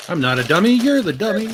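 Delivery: ventriloquist dummy voice. Pretend.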